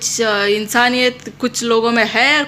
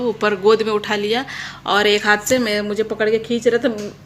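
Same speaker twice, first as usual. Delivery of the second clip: usual speaking voice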